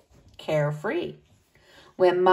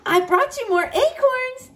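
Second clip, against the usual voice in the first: bright bunny voice; 12 semitones higher than usual. Acted.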